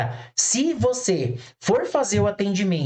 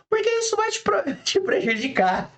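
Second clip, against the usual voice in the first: high-pitched, laughing through the words; 13 semitones higher than usual. Real, not acted. Teacher explains why to a trainee, laughing. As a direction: let the laugh break into the explanation.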